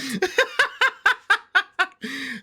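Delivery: long, very high cackling laugh; real laugh. Real, not acted. Viewer still amused by the title drop, high real laughter. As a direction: keep giggling high and helplessly.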